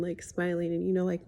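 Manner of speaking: ordinary talk, nothing marked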